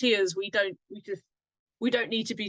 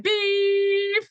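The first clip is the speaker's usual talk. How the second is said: long, high-pitched held beep; censor beep; 12 semitones higher, 6 dB louder than usual. Pretend. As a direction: mimic a bleep over censored words.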